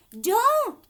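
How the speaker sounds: sharp, high, loud warning cry; alarmed shout. Acted. Wonka crying out as Violet grabs the gum. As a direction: stop her in alarm.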